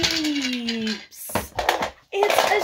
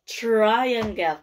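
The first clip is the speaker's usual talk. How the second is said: ordinary talk, nothing marked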